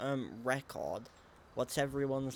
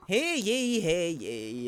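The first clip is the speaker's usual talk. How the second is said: high loud herald call; town crier voice; 7 semitones higher, 8 dB louder, much slower than usual. Pretend. Mock wedding announcement.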